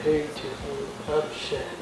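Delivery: low, soft imitation of his reply; Shaq. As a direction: drop the pitch for a big man's greeting. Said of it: "Hi, Shaq".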